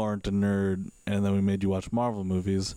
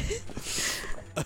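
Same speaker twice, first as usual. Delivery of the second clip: breathy silent laugh; 5 dB quieter than usual. Real, not acted. Laughing at the joke.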